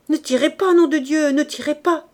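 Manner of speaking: loud, high-pitched panicked plea; terrified shouting. A narrator's reading. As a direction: scream at gunmen to hold fire. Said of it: "Sa voix montait, rauque, épouvantée".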